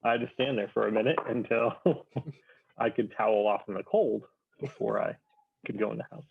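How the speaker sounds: ordinary talk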